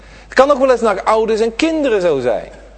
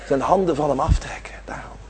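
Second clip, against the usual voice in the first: whispered, faster, as if it is too heavy to say aloud; hushed whisper; 4 semitones lower, 6 dB quieter, faster than usual. Real, not acted. Preacher whispers God's withdrawal to the congregation, hushed. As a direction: breathe the words out on a whisper.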